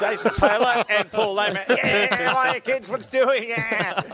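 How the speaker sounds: high, hammed-up host introducing the guests; put-on high, excited showman voice; mock kids' entertainer. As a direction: play up a children's show greeting, laughing.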